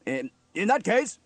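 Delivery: high, loud stern officer. A narrator's reading. Steele reasserts himself before the crew, stern. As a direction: stumble briefly, then take command. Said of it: "said Steele sternly".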